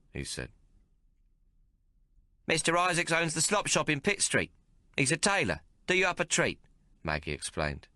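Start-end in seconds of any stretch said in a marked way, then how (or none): 2.5-6.6 put-on high, breezy street girl; brash London lass showing off her tailor; Maggie's cockney voice. A narrator's reading. Maggie introducing the tailor to Hawk, brash and cheerful.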